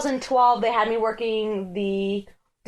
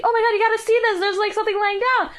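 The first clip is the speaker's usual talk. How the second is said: mock excited girl; 10 semitones higher, much faster than usual. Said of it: "She's like".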